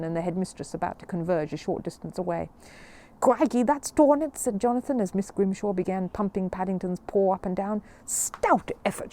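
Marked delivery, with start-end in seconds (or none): 3.2-4.4 dismayed exclamation. Acted. Boy alarmed at headmistress approaching. 8.0-9.1 slow, breathy hearty praise; a hint of a booming headmistress; mildly voiced jolly headmistress. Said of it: "she cried".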